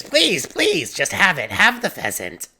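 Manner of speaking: high-pitched begging, easing down; frantic pleading. Acted. Villager begs Igvis to take the pheasant, frantic pleading. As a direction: leap up on the pleases in mock desperation.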